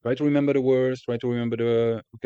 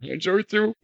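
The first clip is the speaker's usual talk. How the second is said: teasing echo; 11 semitones higher, 4 dB louder than usual. Pretend.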